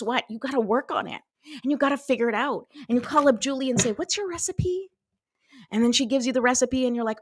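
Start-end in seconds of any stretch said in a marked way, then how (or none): 3.9-4.9 high sweet ask. Retold or imitated. Asking Julie for recipe.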